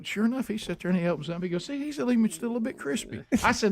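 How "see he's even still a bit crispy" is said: high mock buddy voice; mimicking friend. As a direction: pitch him up high, coaxing.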